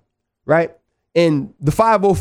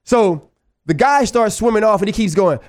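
ordinary talk, nothing marked

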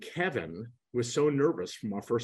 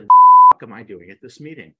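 indignant outburst; 3 semitones higher, 25 dB louder than usual. Retold or imitated. Retelling his outburst at the recruiter, loud indignant. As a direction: blow up at the surprise lunch.

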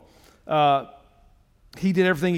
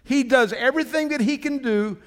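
ordinary talk, nothing marked

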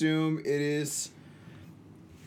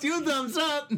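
mocking jeer; 9 semitones higher, slower than usual. Real, not acted. Mocking friend's earlier praise.

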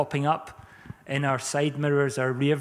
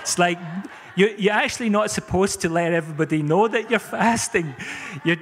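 normal talking voice

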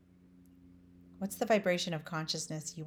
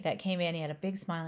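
ordinary talk

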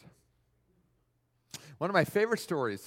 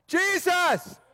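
high, drawn-out call for mercy; acted beggar's shout; 9 semitones higher, 3 dB louder than usual. Blind man hears Jesus is near and calls out, shouting. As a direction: holler it high over a crowd. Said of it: "He shouts".

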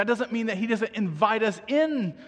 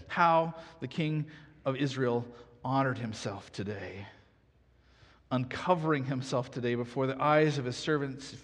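steady, lower scripture read; 4 semitones lower than usual. Acted. Pastor reads the Bible story aloud to the congregation, measured. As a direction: read the passage evenly to the church.

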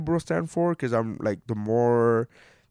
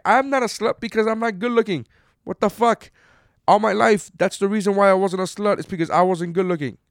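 higher and louder, fired up and incredulous; indignant outburst; 8 semitones higher, 4 dB louder than usual. Real, not acted. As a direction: let the outrage spill over. Indignant rant about being unattractive.

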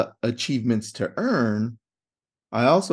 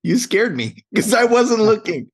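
startled; 9 semitones higher, 5 dB louder than usual. Real, not acted. Startled cohost after a jump scare.

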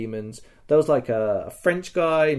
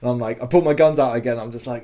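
usual speaking voice

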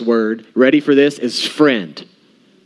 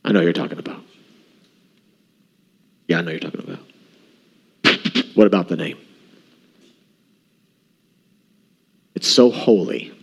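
hushed, reverent voice, much softer; 7 semitones lower, 4 dB quieter, faster than usual. Retold or imitated. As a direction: lower and quiet, in awe of the name.

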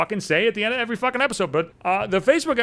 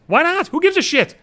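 heated rant; 9 semitones higher, 3 dB louder than usual. Real, not acted. Ranting, pitch jumps up.

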